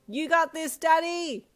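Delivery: high, loud cheer. Acted. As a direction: shout encouragement across the gym. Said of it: "you called to him".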